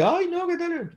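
voz mucho más aguda, casi llorando; imitando al mánager lloroso. Retold or imitated. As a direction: lamento agudo, medio quebrado, en burla. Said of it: "así como que casi que llorando". (el mánager casi llorando, imitado con voz aguda y quejumbrosa)

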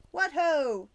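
shouted. A narrator's reading. The teacher in Roman armor rallies the class, shouting. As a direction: boom it like a knight charging. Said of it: "and shouted".

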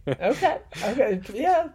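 high amused okays; surprised okay. Real, not acted. Surprised at afternoon meaning.

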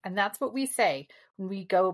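ordinary talk, nothing marked

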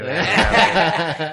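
loud laugh. Real, not acted. Laughs at his own story.

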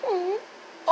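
high, soft mock dog hum. Dog staring at his chips, high mock dog hum. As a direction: voice the dog's knowing hum.

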